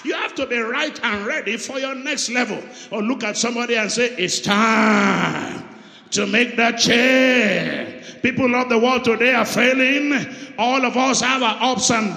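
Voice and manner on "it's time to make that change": drawn out, loud proclamation; emphatic pause. Acted. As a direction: lean on time, then slowly on change. Preacher declaims the call.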